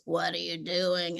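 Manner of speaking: slow, drawn-out eerie imitation; weird, dragging funky voice. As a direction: imitate a groggy mother, slow and odd. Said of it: "in this funky voice".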